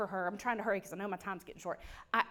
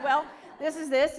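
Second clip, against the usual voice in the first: said through a laugh, a bit louder; 4 semitones higher, 6 dB louder than usual. Retold or imitated. Explaining the synagogue to her friend, laughing.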